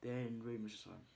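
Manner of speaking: usual speaking voice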